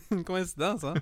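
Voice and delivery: laughing. Real, not acted. Laughing repeat of coincidence.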